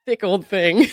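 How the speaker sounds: amused quote. Retold or imitated. Amused by lawyer's quoted phrasing.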